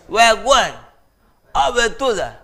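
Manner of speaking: high, loud street greeting. Retold or imitated. Teacher mimics the street greeting, high and loud. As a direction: call it out the way they did. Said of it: "they used to say".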